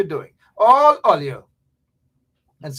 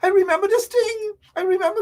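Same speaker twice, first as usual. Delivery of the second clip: very high squealing delight; a lady tasting guinep mimicked with high shrill joy; mock excited lady; 3 dB louder, faster than usual. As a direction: imitate her rapture over guinep. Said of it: "and she said".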